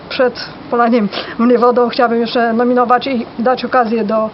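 ordinary talk

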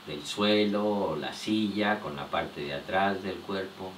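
soft, low voice in short phrases with long pauses; hushed, unhurried body-scan meditation; guided relaxation. Real, not acted.

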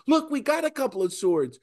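high, mock-eager offer; eager disciples. Disciples offering Jesus their swords, high and mock-eager. Disciples voiced again, mocked.

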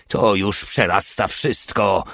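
usual speaking voice

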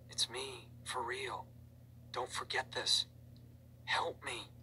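ghostly hushed voice; hushed, eerie message. A narrator's reading. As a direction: whisper it like a message from the dead. Reading eerie message from dead friend.

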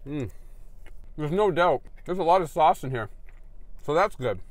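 talking between bites; speaking while eating burger; mouth full, chewing. Real, not acted. Seen 2: bites at -1.0, lips closed chewing, then talks with burger held.

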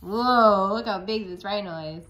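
usual speaking voice

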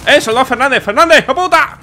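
loud high furious yelling; angry shouting. Real, not acted. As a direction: scream curses at Fernández. Shouting at a soldier.

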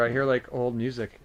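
usual speaking voice

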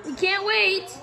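ordinary talk

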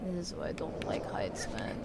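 quiet, half-whispered grumble about heights. Real, not acted. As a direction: say it under your breath, uneasy. Scared by heights in the game.

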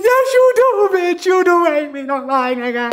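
high, hammed-up tearful wail; imitated crying. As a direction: whine it through mock tears, easing down at the end. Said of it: "obviously I'm already crying".

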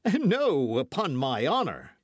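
high, loud protest; crying out. A narrator's reading. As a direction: exclaim it as an oath of honour. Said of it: "cried his Majesty's guardsman".

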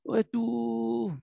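high-pitched drawn-out "waduh"; delighted exclamation. Shown as a demonstration. Teacher shows how someone who likes it reacts, delighted. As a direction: let it rise with expressive delight. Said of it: "Kalau kita suka kan bilang".

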